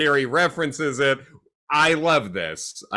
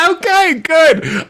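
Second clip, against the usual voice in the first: laughing; 9 dB louder, much slower than usual. Real, not acted. Laughs at his pool memory.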